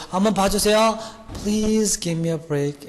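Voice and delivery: high, drawn-out begging plea; student pleading with the teacher, stretched out. Pretend. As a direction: beg the teacher for mercy. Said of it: "영어 한답시고".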